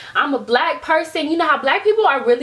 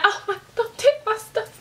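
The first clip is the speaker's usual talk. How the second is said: high, feeble pretend whimper; hammed-up timid squeal; mock helpless plea; 7 semitones higher, 3 dB quieter than usual. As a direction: plead weakly, as a helpless victim. Imagined helpless victim of a robbery.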